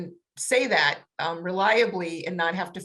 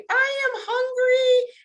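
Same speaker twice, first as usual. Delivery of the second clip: "I am hungry" sung out much higher and slower, stress on "I"; emphatic modelling; 5 dB louder, slower than usual. Shown as a demonstration. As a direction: hand the child the right words, high and slow. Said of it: "use that tone and say".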